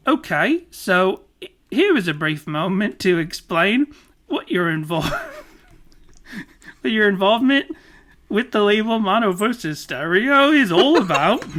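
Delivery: hammed-up Australian accent, loud and lilting; bad Australian accent with rising ends; Dinny. Podcaster imitates Australian interviewer, hammed-up Aussie accent. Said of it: "in my Dinny impression".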